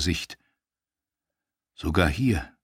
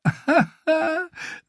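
shrill mocking cackle, much higher; high cackling laugh. Holly laughing at Theon before she taunts him, high cackle. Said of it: "Stechpalme lachte".

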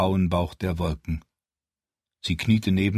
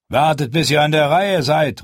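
loud shout to a crowd, pitched higher; calling out; 7 semitones higher, 6 dB louder than usual. Acted. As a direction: call out over the pushing crowd. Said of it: "riefen sie".

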